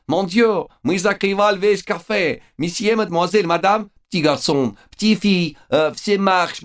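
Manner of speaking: put-on very high, frantic French-accented shouting; panicked French chef. A narrator's reading. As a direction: shoo customers out in a French panic. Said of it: "крича на ходу".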